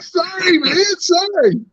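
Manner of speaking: high, whiny pleading; hammed-up sorry, drawn out high; mock apology. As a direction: grovel in a squeaky, mock-tearful voice. Acting out pleading apology to buddy.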